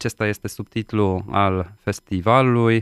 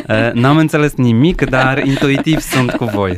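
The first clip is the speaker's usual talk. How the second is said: laughing; 4 semitones higher, 7 dB louder than usual. Real, not acted. Interpreter laughs while translating joke.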